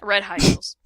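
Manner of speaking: ordinary talk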